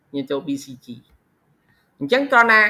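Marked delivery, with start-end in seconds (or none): none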